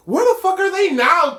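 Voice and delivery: high, loud show-title holler; playful high-pitched announcer call; segment shout. Pretend.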